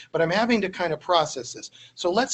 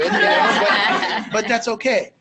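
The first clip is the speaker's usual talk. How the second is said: laughing; 4 semitones higher, 6 dB louder, slower than usual. Real, not acted. Imagines cabbie's question, laughing.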